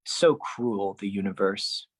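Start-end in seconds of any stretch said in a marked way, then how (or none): none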